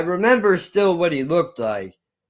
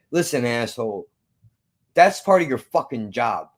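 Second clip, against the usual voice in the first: usual speaking voice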